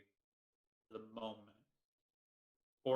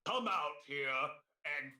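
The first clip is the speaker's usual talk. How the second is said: menacing dragon voice; 3 dB louder than usual. Acted. Dragon speaks to the party.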